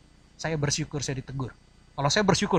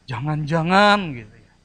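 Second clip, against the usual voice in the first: suspicious; 6 dB louder than usual. Acted. Acts suspicious superstitious thought.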